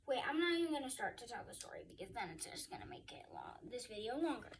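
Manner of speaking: fast whisper, as if a quick secret; whispered aside. Real, not acted. Vlogger to viewers, skipping the long story, hurried whisper. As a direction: drop to a rushed whisper and hurry through.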